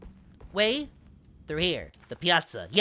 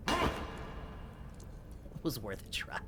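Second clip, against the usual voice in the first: slow, soft whisper; 6 dB quieter, much slower than usual. Real, not acted. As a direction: whisper it slowly to yourself. Whispered aside.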